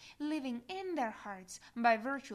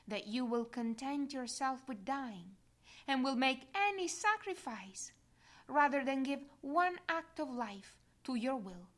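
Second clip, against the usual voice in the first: usual speaking voice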